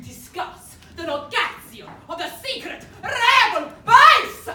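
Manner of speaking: building to a huge, high villain roar; mock villain boom. Wrong-script villain threatening the prisoner, hammed-up roar. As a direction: ham up the evil interrogator. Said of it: "Oh, sorry. Wrong interrogation".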